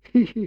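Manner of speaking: high, mocking hee-hee giggle; nasty chuckle. A narrator's reading. Nasty little man in the waiting room giggling high and mocking. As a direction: giggle spitefully at the boy. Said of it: "klukkede han".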